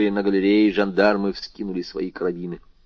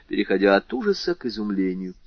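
ordinary delivery, nothing marked

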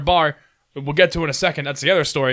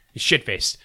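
usual speaking voice

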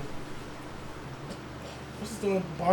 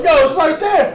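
loud exasperated yell, pitched up high; yelling back; 9 semitones higher, 9 dB louder, slower than usual. Retold or imitated. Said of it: "I'm like".

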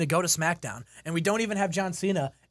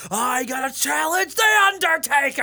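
high, loud mock wrestler promo; hammed-up challenge shout; mock Cena shout; 8 semitones higher, 5 dB louder than usual. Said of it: "he's like".

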